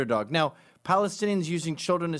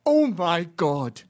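mock anguish; 6 dB louder, much slower than usual. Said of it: "And he goes".

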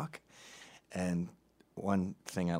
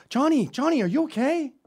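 high, loud alarmed call; pitched-up mock alarm; imitated worried wife; 8 dB louder than usual. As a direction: call out like a panicked mother. Worried wife calls to injured son.

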